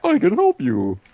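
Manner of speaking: very high squeaky fish voice. Retold or imitated. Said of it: "The golden fish calls out to you".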